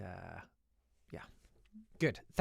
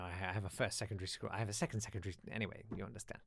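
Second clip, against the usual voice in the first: normal talking voice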